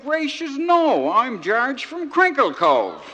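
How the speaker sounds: high, loud indignant exclamation; astonished protest. Acted. George correcting the cab driver, astonished protest. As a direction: protest it loudly, stretching the last words slowly.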